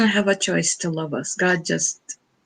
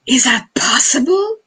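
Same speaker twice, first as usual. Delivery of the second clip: gasp, then higher-pitched amazed disbelief; awed gasp; 11 semitones higher than usual. Acted. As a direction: catch your breath in mock astonishment. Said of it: "it's just like".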